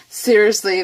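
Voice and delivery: ordinary delivery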